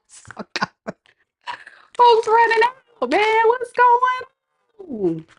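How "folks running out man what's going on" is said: high, slow, incredulous. Real, not acted. About viewers leaving the stream, high mock disbelief. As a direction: raise the pitch in mock disbelief.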